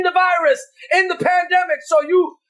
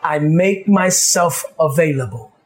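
a pastor praying low and hushed over his people; fervent prayer; 11 semitones lower than usual. Acted. Leads the congregation in prayer.

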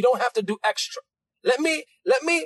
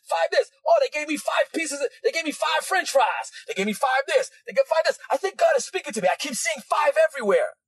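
higher-pitched, breathless-style rush of discovery; mock excitement; 4 semitones higher than usual. Preacher mimics believer seeing five everywhere, mock excitement. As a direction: imitate someone marvelling at every sign.

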